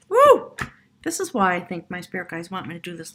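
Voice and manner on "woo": high, loud woo; excited whoop. Acted. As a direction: let out a big whoop. Excited cheer.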